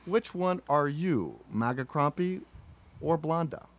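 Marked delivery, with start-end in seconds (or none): none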